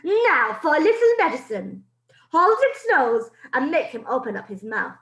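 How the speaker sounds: put-on a witch about to poison the boy; gloating witch. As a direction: savour the cruelty.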